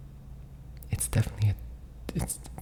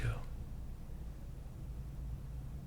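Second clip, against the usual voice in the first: whispered laugh; 15 dB quieter than usual. Real, not acted. Embarrassed about bad photos.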